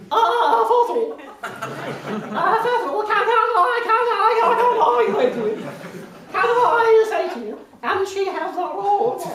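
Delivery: loud, high, breaking-up voice; Anne Widdecombe. Shown as a demonstration. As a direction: impersonate a voice like a mobile phone with bad reception. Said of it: "she sounds like a mobile phone with bad reception".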